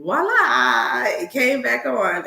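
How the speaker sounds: high, loud, drawn-out voila; slow, elated high-pitched announcement; triumphant reveal. Real, not acted. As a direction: present it like a magic trick. Triumphant reveal of computer restarting.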